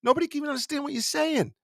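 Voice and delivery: mock yell, pitched high; yelling up at a worker on a ladder; retold yell up a ladder. To the man up the ladder, a high mock yell. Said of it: "I look up, I go".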